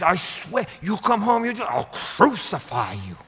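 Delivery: high, loud mock mother threat; imitating mom. Mother threatening her son, hammed-up high shout. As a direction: ham up a fierce mom scolding. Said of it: "She says".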